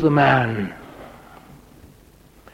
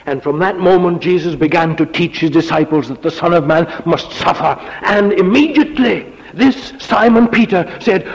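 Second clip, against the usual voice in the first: louder and higher, driving the story; full-force preaching; 6 semitones higher, 3 dB louder than usual. Acted. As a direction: preach it out with rising fervor.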